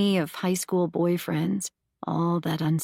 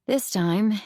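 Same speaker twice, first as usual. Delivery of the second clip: ordinary delivery, nothing marked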